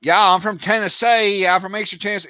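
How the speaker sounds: high, hammed-up Southern twang; overdone Tennessee accent. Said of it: "that's not how they talk there".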